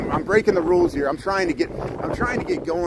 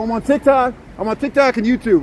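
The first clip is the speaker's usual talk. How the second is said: excited; 6 semitones higher, 4 dB louder than usual. Real, not acted. Tells fans his channels.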